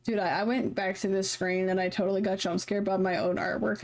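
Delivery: ordinary delivery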